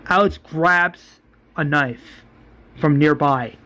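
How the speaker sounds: ordinary delivery, nothing marked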